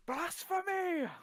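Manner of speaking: high, drawn-out cry of mock outrage; outraged cry. Hobbit denounces the shoes on his feet, outraged cry. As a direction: draw it out like a scandalised hobbit.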